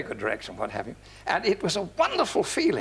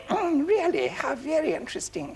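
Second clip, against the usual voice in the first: high, polite princess voice; imitated princess; 10 semitones higher than usual. Princess replying at dinner, imitated high polite voice. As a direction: put on a high, regal politeness. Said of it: "she said".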